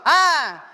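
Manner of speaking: a sudden high, loud scream of despair; desperate cry. Acted. Servant seeing the enemy army, desperate scream. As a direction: cry out as if doomed. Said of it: "Estaba desesperado y gritó".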